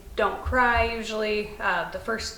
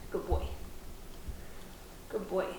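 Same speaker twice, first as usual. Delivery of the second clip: soft, gentle praise to a puppy; quietly reward the dog, unhurried; 2 semitones lower, 11 dB quieter than usual. Real, not acted. Trainer rewarding puppy for good manners, soft praise.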